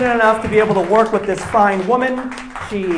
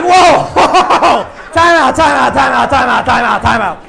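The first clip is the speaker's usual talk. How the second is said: louder laughing whoa, fading time outs; alarmed time out; 3 semitones higher, 4 dB louder than usual. Real, not acted. Comedian reacting in shock to his wife's warning. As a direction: throw up your hands and stop everything.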